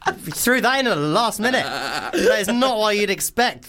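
high-pitched, amused outburst; astonished. Real, not acted. Host reacting to the penguin twist in an email, astonished.

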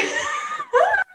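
a very high laughing shriek. Real, not acted. Shrieking with laughter as the co-host doubts her story. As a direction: let out a squealing laugh, almost a shout. Denying she told the story before.

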